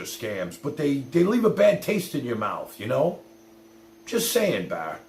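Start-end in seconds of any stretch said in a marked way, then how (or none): none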